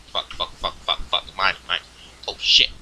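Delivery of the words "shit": ordinary talk, nothing marked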